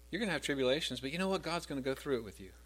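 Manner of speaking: ordinary delivery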